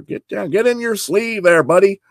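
usual speaking voice

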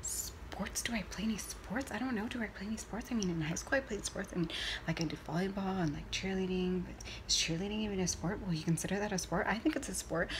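quiet anxious inner voice; flustered murmur. Retold or imitated. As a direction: mumble it to yourself in a fluster.

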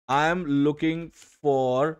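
very slow, word-by-word reading of a typed prompt; spelling out a ChatGPT prompt slowly; slow dictation. Shown as a demonstration. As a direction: dictate each word as if typing it. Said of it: "chat GPT में जाओगे और बोलोगे".

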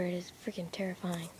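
scared hushed whisper. Real, not acted. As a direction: whisper it, keep it hidden. Whispering in the dark woods.

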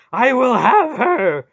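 very high, slow bellow; drawn-out, raging shout; howled demand. A narrator's reading. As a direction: howl it with furious possessiveness. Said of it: "Mara howled".

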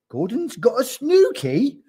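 high, loud, incredulous question; crying out. A narrator's reading. Giraffe passes gossip to the tiger, crying out. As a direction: cry it out in disbelief. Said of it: "cried the giraffe".

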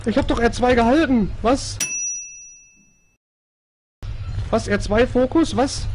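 flustered and frightened; scared horror game streamer. Real, not acted. Panicked confusion at controls.

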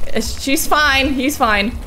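usual speaking voice

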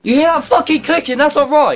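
alarmed exclamation. Real, not acted. Showing clicking knee, alarmed.

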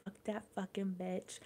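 very soft, under the breath; muttered inner thought. Retold or imitated. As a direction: mutter it to yourself, barely voiced. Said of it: "like, in my head".

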